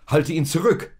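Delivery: loud, high startled shout; frightened shout. Acted. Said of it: "rief Josef erschrocken aus".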